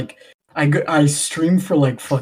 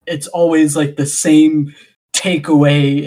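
ordinary delivery

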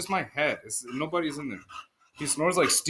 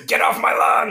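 loud, rough mock bark; grumpy old man; 10 semitones lower, 7 dB louder than usual. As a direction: bark it like a cranky homeowner. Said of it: "Half Sack as an old grumpy".

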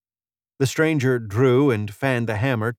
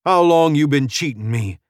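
loud, higher-pitched accusation; angry shout; 5 semitones higher, 5 dB louder than usual. A narrator's reading. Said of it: "Spence shouted".

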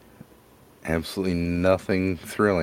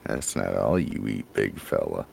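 teasing low voice; 8 semitones lower, 4 dB quieter than usual. Acted. Teasing his friend.

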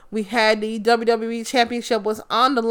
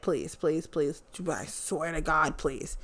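soft, low begging; low, soft, weary plea; quiet pleading; 6 semitones lower, 12 dB quieter, slower than usual. Real, not acted. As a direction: plead under your breath. Begging Goldberg to leave.

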